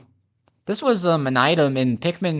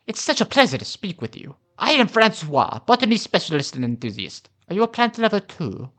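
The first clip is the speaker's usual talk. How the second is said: higher, badly faked French accent; hammed-up, amateur French accent; 7 semitones higher than usual. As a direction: play a French botanist with a clumsy accent. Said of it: "I do not know how to do a French accent".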